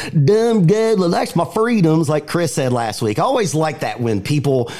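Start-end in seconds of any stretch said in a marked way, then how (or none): 0.1-2.1 mocking a Southern accent, "my" said as "mah"; a hint of a put-on Southern accent. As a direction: parody a Southern good ol' boy's accent. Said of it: "they always affect a Southern accent".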